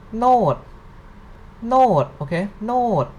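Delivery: note long, high, then dropping; falling-tone drill. Shown as a demonstration. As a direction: swoop each note down from high. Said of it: "it represents the falling tone".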